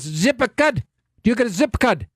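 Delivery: a hint of Nigerian accent, repeating zip code; imitating the Nigerian gas station attendant's accent; mock Nigerian accent. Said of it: "heavy Nigerian accent".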